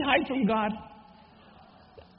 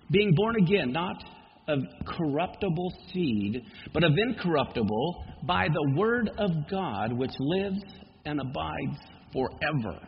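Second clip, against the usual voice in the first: lower, slow, deliberate verse; slow scripture reading; 5 semitones lower than usual. Acted. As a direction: read it slowly, weighing each word. Reads First Peter.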